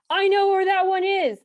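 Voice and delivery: high, loud mock-excited outburst; excited imitation. Imitating foreigners who hear she's from Texas, excited shout. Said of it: "People get really excited".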